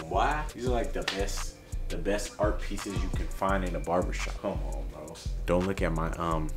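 ordinary talk

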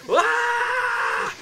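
piercing high mock scream. Retelling how he screamed at the ghost in his room, a high scream. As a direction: let out a sudden terrified yell. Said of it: "I screamed".

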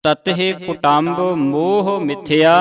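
slow, measured chanting of the verse; scripture recitation. Acted. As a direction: intone the shloka slowly, syllable by syllable. Recites the shloka.

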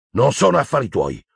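brusque reply. A narrator's reading. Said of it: "rispose brusco Harry".

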